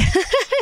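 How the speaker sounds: quick bright burst of high laughter; high giggle. Real, not acted. Laughing while telling viewers about her friend, high giggle. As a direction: giggle as the friend's name comes up.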